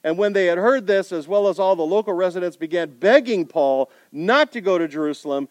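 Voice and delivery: usual speaking voice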